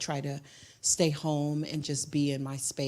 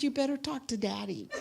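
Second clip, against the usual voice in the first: quick, higher, half-laughing; laughing joke; 8 semitones higher, 5 dB quieter, faster than usual. Retold or imitated. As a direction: tease through a laugh, quicker. Joking comeback, laughing.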